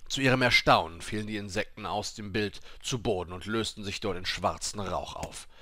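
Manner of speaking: plain reading voice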